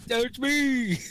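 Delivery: high, loud silly put-on voice; mock goofy voice. Friend adding to the joke, in a high silly put-on voice. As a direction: play a dopey fan calling out.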